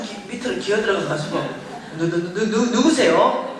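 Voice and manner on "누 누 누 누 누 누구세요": terrified stammer. Retold or imitated. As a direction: stutter it out in comic panic. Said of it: "얼마나 무서운지 알아요".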